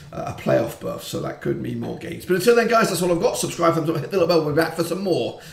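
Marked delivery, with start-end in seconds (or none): 2.2-5.4 outro. Real, not acted. Youtuber outro sign-off.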